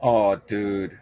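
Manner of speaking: ordinary delivery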